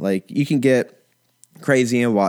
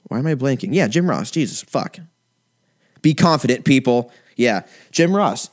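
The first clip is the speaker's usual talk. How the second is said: ordinary talk, nothing marked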